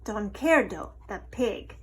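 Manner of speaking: plain reading voice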